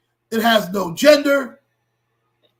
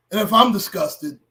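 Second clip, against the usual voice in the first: usual speaking voice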